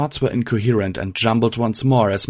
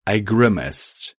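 ordinary delivery

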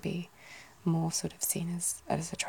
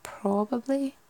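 higher-pitched Irish try with a stressed O; attempted Irish accent; 6 semitones higher, slower than usual. Shown as a demonstration. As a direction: stretch the O, aiming for Irish. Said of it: "I think Ireland".

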